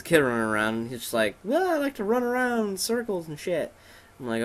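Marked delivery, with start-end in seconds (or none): 1.4-3.7 high, put-on child voice; silly mock-child voice; little kid. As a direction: pitch it way up like a goofy kid. Voicing a kid running around.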